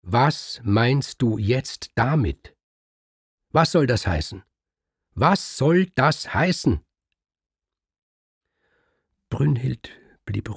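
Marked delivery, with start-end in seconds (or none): 0.0-2.6 slow, higher, louder demand; raised, indignant voice; getting loud. Acted. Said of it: "Da wurde Kriemhild laut". 3.5-4.4 higher, louder indignant question; first demand, already raised. Acted. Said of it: "Da wurde Kriemhild laut". 5.1-6.8 much higher, near shouting; louder still. Acted. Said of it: "Da wurde Kriemhild laut".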